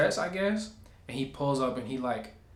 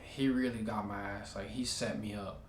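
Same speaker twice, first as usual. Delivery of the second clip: softer, lower muttered aside; quiet inner voice, realizing the trap; inner thought; 3 semitones lower, 7 dB quieter than usual. Retold or imitated. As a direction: say it to yourself, defeated. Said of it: "And in my head".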